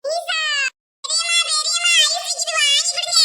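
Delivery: put-on high, drawn-out child's begging; child pleading. Child asks aunt to buy ice cream, high-pitched pleading. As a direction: call out and beg like an eager child.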